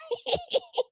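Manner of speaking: high-pitched giggle; high laugh. Real, not acted. Laughing at the husband's line, high giggle.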